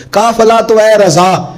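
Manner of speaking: slow, lifted recital of a naat couplet; slow verse recital. Retold or imitated. Preacher calling listeners in with a verse, slow recital. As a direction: stretch the couplet out as a chanted line. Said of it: "ہم تو کہتے ہیں".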